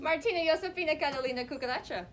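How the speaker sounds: loud, fast crowing suitor voice; rooster suitor. Acted. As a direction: belt it out like a proud rooster. Rooster's courting chant.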